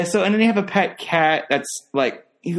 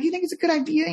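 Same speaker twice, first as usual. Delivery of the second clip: very high imitated kid question; imitating wheedling kid; 13 semitones higher, 3 dB quieter, faster than usual. Showing how the kid talks when asking, high wheedling voice. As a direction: mimic the kid's hopeful upward lilt. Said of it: "that's how he talks".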